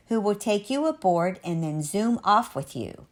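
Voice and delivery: plain reading voice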